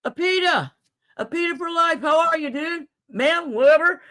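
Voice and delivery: sing-song greeting. Real, not acted.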